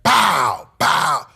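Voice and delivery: two loud, high-pitched pows; shouted punch sounds. Retold or imitated. Son retelling beating his father, shouted mimicked blows. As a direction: shout out each imitated blow.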